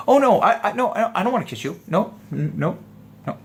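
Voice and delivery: high, stammering mock protest; fake flustered denial. Shown as a demonstration. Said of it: "you're not being genuine".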